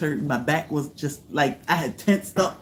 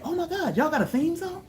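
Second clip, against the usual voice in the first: high, squealing mock excitement; people gushing; 12 semitones higher, 4 dB quieter than usual. Fans asking about the theme song, high and gushing. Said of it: "People are like".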